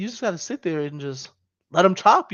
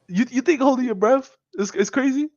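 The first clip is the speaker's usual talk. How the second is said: incredulous; 8 semitones higher than usual. Real, not acted. Incredulous question, high pitch.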